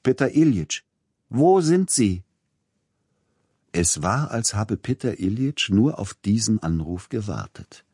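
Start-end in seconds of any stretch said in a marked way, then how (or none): 0.0-2.2 raised voice calling across the platform. Acted. Friend on platform calls to Peter Iljitsch, loud call. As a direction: call out to find him.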